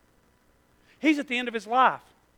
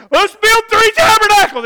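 high, loud eager shout; excited outburst; 13 semitones higher, 16 dB louder than usual. Acted. Peter shouting his idea on the mountain. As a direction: blurt it out with wild excitement.

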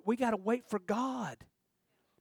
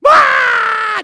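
put-on high, loud wailing child's scream; toddler's scream; 17 dB louder than usual. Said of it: "Just screaming, top of lungs".

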